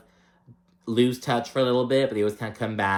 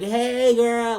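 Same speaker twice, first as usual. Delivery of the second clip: much higher, loud gushing hello; excited greeting; 12 semitones higher, 4 dB louder than usual. Retold or imitated. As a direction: squeal it with super fun vibes. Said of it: "Alexia is instantly all excited".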